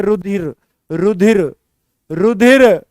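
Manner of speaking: रुधिर said clearly with a dh sound, not रचिर; correct pronunciation. Shown as a demonstration. Teacher corrects students, stressing रुधिर's dh sound. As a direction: spell out रुधिर carefully, syllable by syllable. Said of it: "ये रचिर नहीं है".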